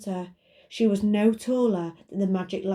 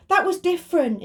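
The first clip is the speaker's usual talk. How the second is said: quick interrupting lamp voice; 10 semitones higher, 6 dB louder than usual. Acted. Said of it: "interrupted the magic lamp".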